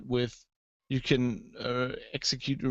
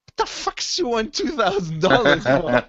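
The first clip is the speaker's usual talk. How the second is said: higher, louder mock disbelief; incredulous clerk; 7 semitones higher, 6 dB louder than usual. Clerk turning to the narrator, mock bewildered. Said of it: "looks at me and goes".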